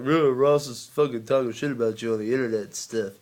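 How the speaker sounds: put-on dopey tattler voice; mock tattletale. Imitating people tattling to his old bully, mock tattletale voice. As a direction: imitate someone telling on you, in a silly voice.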